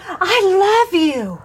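lightly voiced small boy, slow and sweet; a hint of a toddler calling after you; little boy's voice. A little boy calls I love you down the aisle, lightly voiced child.

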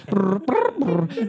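mock robot whirr. Terminator scanning imitation.

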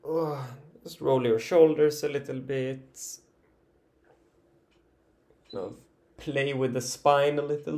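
stretching while talking. Real, not acted. Guide leaning side to side with listeners, stretching while talking. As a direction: talk through a real full-body stretch.

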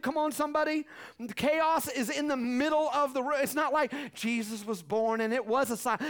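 ordinary talk, nothing marked

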